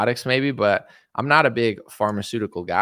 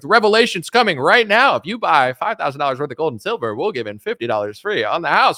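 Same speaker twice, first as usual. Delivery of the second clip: higher-pitched mock doomsday sales pitch; doomsday gold salesman; 4 semitones higher, 4 dB louder than usual. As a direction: ham up a panicky gold seller. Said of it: "that guy that was like".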